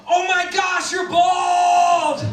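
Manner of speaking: high, loud mock outcry of a whole class; shocked kids. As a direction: shriek it like astonished five-year-olds. Whole class exclaims at bald teacher.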